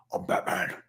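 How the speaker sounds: whispered, rasping superhero voice; hushed, menacing hero growl; Batman. Acted. Plays Batman in a skit.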